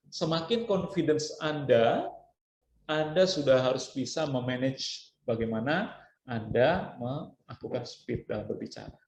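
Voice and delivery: ordinary delivery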